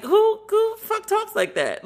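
mock outrage, voice jumping high; incredulous outburst. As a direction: pitch shoots way up in disbelief. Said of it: "And I was like".

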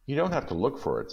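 wife urging husband the hair is sold; Della insisting. Acted. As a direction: hurry to make him understand. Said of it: "said Della".

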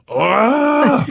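a high, stretched Ah of a roaring crowd; traders' giant roar, a high imitated Ah; roaring crowd yell. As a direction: imitate a trading floor erupting. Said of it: "this giant".

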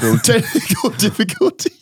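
high, giggly delivery; words breaking into real laughter; laughing apology. Real, not acted. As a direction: laugh through the technical slip. Laughing through botched playback.

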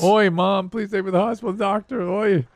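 high, hammed-up Yiddish-accented whine; mock Yiddish accent. Son begging mom for hospital, mock Yiddish accent. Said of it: "I didn't do it in that accent".

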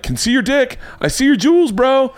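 high teasing commenter voice; mocking commenter. Host imitating commenters spotting his nudity, high and teasing. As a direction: tease him like a gleeful troll. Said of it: "everyone's like".

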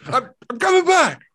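high, loud rallying shout; hammed-up, high-pitched triumphant yell; Leo DiCaprio. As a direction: yell it like a triumphant movie speech. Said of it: "went all Leo DiCaprio".

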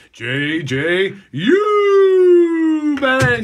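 loud, high, hugely stretched player introduction; booming hype call with a long held vowel; arena announcer. Retold or imitated. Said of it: "as the announcer used to say".

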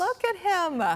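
high, gushing coo; overwhelmed by cuteness. Real, not acted. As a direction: gush over the kitten. Anchor cooing over kitten photo.

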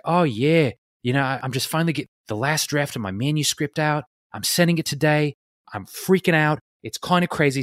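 high-energy imitation of an Australian friend; Tess's Australian accent. Podcast guest mimicking friend at the mailbox, Australian accent. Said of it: "I'll try and do Tess's accent".

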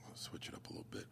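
near-whisper to himself; very soft, whispered aside; under his breath. Real, not acted. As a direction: talk to yourself barely aloud. Muttering to self while browsing.

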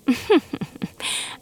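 unvoiced sly cat snicker; breathy mocking snicker. A narrator's reading. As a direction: snicker at the labels. Cat mocks flavors.

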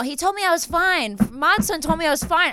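high-pitched mock complaint; mock protesting. Client complaining about being reassured over tattoos, mock protest. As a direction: pitch it way up, playfully aggrieved.

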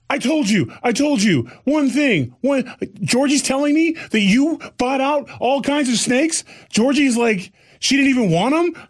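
high scolding rant; hammed-up nagging wife voice; mock scolding wife.

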